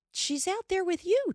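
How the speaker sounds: high startled mother; taken aback, pitch jumps up; surprised. A narrator's reading. Ma startled, voiced in story.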